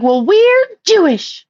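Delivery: put-on high, slow, smug little-girl voice; mocking girls' reply. Mocking the girls at the playground in a high, slow bratty voice. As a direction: squeak it out like a bratty kid.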